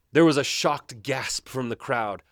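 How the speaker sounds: ordinary delivery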